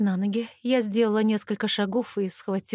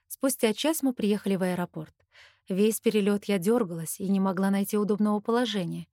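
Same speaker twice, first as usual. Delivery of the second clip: plain reading voice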